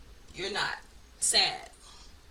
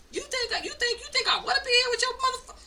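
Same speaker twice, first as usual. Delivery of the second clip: sassy retort; 6 dB louder than usual. Acted. Mock retort to people holding her back.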